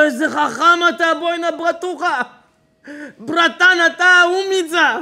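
hammed-up crook hailing his buddy; admiring crony. Second kidnapper praising his clever buddy, loud and thrilled.

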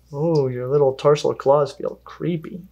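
ordinary delivery

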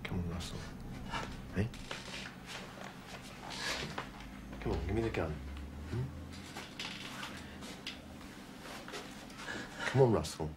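soft, low, patient persuasion; coaxing. Acted. Policeman coaxes Russell to hand over the gun, soft. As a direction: talk him down gently.

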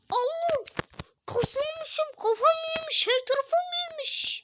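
high-pitched amazed cry at the mirror; shocked at new muscles. Acted. Muscle man shocked by his reflection, high astonished voice. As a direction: gasp it out, way up high, stunned.

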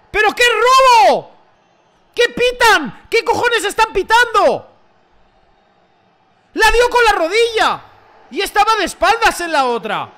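high, loud outraged ranting; angry shouting. Real, not acted. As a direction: protest the call at full volume. Raging at referee decision.

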